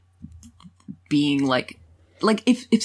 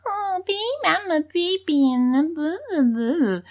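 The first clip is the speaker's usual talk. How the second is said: put-on high babyish babble, sliding down; mock baby talk; 10 semitones higher than usual. Mocking modern baby talk in bed, high babyish voice.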